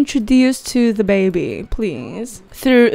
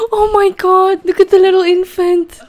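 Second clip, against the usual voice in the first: gasping delight; 9 semitones higher than usual. Real, not acted. Player gushing over a newborn sim, excited coo. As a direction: melt with delight at the tiny infant. Gasps seeing the baby sim.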